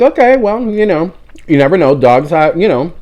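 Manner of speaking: high, fumbling polite reply; awkward reassurance. Retold or imitated. Brushing off the apologizing owner, high and awkward. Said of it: "I was like".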